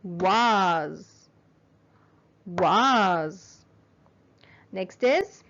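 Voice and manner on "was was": Was said once, one clap to the beat; clapped syllable. Shown as a demonstration. Teacher leads class clapping, one beat per word. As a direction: clap along on each Was. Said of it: "So let's clap".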